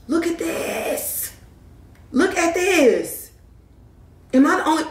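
high, slow, delighted squeal; gushing to viewers over the last drop, high and slow; excited squeal. Real, not acted. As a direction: coo over it like a treasure. Thrilled showing product's last drop.

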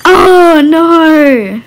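high, loud cry of alarm; loud dismayed shout. Real, not acted. Kid seeing something break, loud shout. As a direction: shout it at the screen.

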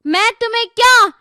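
high, declaimed rhetorical question; challenging question. Acted. Girl questioning the world about being a doll, high challenge. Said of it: "Neetu ने यही प्रश्न पूछी".

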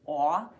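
"aw." said as the open aw of ball; aw sound. Shown as a demonstration. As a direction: give "aw." the open vowel of ball, held a beat. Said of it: "We also read the letter A like aw".

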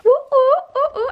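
high excited ooh squeals. Real, not acted. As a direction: squeal with delight. Reacting to a gift.

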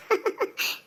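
soft, chuckling laugh of triumph; gleeful laugh. Acted. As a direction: chuckle to yourself, delighted to get away. Laughs in glee at escaping. (மகிழ்ச்சிச் சிரிப்பு)

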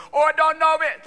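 high, loud mock-Jamaican accent; fake Jamaican accent. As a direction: put on a hammed-up Jamaican accent. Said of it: "that Jamaican accent going".

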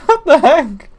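high-pitched surprised laugh; startled laugh. Real, not acted. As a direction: yelp in surprise then crack up. Startled by something in game.

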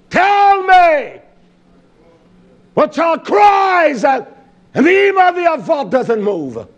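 full-force preacher shout; preaching shout. Acted. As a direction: call it out to the congregation.

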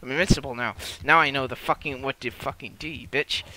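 usual speaking voice

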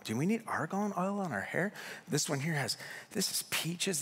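ordinary talk

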